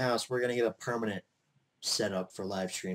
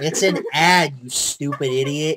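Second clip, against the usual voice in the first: loud teasing; 3 semitones higher, 10 dB louder than usual. Real, not acted.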